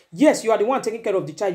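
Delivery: ordinary delivery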